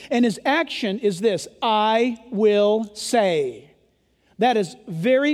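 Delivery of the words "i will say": very slow. Shown as a demonstration. Preacher drawing out quote.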